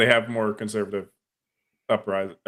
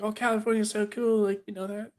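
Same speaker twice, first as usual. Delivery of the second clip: imitated high-pitched fan reaction; mock gushing; 10 semitones higher, 3 dB quieter than usual. Women impressed by his buddy from California, mock gushing. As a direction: gush in a high, softer put-on voice.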